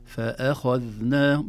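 slow, loud "فأخذناهم" with gently thinned hamza; light without exaggeration. Shown as a demonstration. Teacher models moderation, "فأخذناهم" with light hamza. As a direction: keep "فأ" light but unforced in "فأخذناهم". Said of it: "بدون مبالغة".

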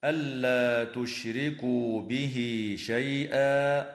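chanted slowly, به linked to شيئا with a lengthened bihi; connected recitation. Shown as a demonstration. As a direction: recite flowing without a stop. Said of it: "قوله تعالى".

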